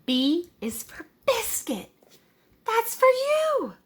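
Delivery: put-on high, slow child voice; a small boy proudly giving a biscuit; slow high kid voice; little boy voice. Tommy feeding the sheep.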